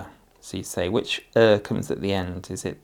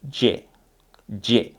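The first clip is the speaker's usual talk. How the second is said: "J" said louder and punched, twice; emphasized sound; 4 dB louder than usual. Shown as a demonstration. Teacher repeating "J" to help the child hear it. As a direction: hit "J" firmly so it stands out. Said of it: "you might need to emphasize it a bit".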